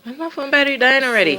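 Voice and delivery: fast, high, loud exasperated outburst; exasperated surprise. Real, not acted. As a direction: blurt it out in disbelief. Phone dying mid-video.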